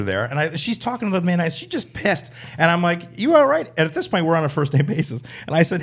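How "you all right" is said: high, loud "you all right" to an upset lady; mock concerned call. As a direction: call across the room with fake concern. Comedian calls to seething woman.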